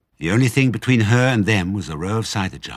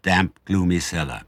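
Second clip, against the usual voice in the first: usual speaking voice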